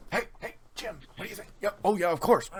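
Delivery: a quiet aside among spectators; crowd murmur. Acted. Crowd members chatting to each other.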